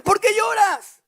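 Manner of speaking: high, loud mock-baffled ¿Por qué lloras?; puzzled husband. Elcana voiced, puzzled at crying wife.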